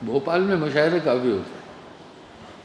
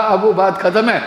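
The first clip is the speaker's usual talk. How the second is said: higher, louder fed-up voice; retold exasperated insistence; 4 semitones higher, 6 dB louder than usual. Elder brother ending the game, raised exasperated voice. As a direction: insist loudly that the game is over.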